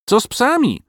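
very high yappy dog; insist, high and whiny; dog asking. A narrator's reading. Garm the dog pressing about dogs, very high and insistent. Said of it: "dopytywał się Garm".

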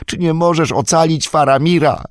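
pleading. Acted. Pippin pleading at Gandalf's knees, high begging voice. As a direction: beg with arms outstretched. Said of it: "wyciągnął błagalnie ramiona".

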